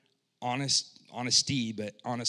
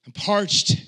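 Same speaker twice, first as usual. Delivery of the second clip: slow, loud, high strained plea; desperate thirst; 6 semitones higher, 9 dB louder, much slower than usual. Retold or imitated. Preacher voicing the psalmist's desperate thirst, loud strained plea. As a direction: gasp it out like a man dying of thirst. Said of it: "It's like".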